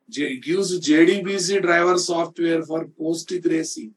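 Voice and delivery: ordinary talk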